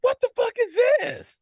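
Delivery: high-pitched, loud outburst of disbelief; freaking out. Acted. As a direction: freak out in a high, outraged squeal. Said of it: "and freak out, like".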